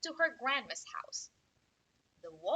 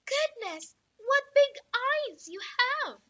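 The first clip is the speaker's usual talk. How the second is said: put-on little girl surprised; 12 semitones higher, 7 dB louder, slower than usual. Girl marvels at grandmother.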